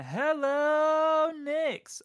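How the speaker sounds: a slow, squealing high hello; big SpongeBob hello. Pretend. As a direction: stretch the hello way up like a giddy cartoon sponge. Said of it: "do the SpongeBob thing".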